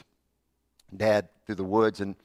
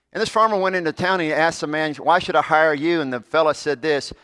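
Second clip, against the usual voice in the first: louder, higher, fast storytelling; full-force preaching story; 7 semitones higher, 8 dB louder than usual. Acted. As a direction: tell it like a revival preacher.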